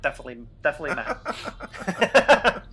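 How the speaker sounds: giggling through "definitely, definitely Matt"; laughing. Pretend. Giggling through the repeated joke.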